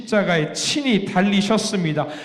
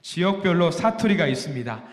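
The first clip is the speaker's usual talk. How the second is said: ordinary talk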